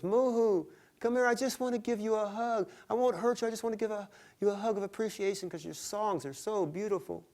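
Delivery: high, sweet coaxing voice; giant woman coaxing. Acted. Giant lake woman luring the songbird down, high and sweet. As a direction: lure him in with a sugary call.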